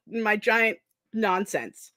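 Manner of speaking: usual speaking voice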